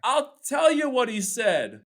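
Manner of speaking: slow, big build-up; wind-up. Acted. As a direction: hold the room before the punchline. Building up the punchline.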